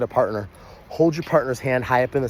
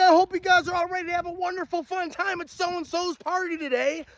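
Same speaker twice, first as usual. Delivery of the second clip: hype host greeting. Acted. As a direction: pump up the crowd like a party host. Party host greeting the crowd.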